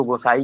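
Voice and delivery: "गोसाई" as a worn-down Goswami; village contraction. Shown as a demonstration. Teacher showing village speech, contracted "गोसाई". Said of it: "गोस्वामी को गोसाई कह देंगे".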